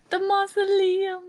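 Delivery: gasping high, awed at the sight; a sudden gasp, then the word breathed out; gasp of surprise. Real, not acted. Friend gasps on seeing the mausoleum on screen.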